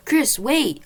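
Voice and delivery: high loud call to stop; calling out to a friend; yell. A narrator's reading. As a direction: shout to stop him. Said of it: "I yelled".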